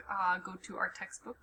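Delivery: quick whispered mutter; whispering. Real, not acted. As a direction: mutter it fast under breath. Muttering while clicking.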